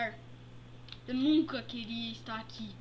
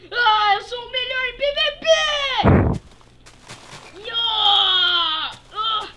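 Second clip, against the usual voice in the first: high, loud fighting yells; battle cries; 8 semitones higher, 11 dB louder than usual. Acted. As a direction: shout while swinging a sword. Fighting Herobrine.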